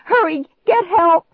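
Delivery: high, loud frantic shriek; panicked shriek. A narrator's reading. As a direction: shriek a desperate warning. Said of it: "I shrieked".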